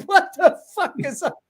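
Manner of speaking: high laughing yelp of disbelief. Real, not acted. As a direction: laugh it out, can't believe the hair. Laughing at friend's curly hair.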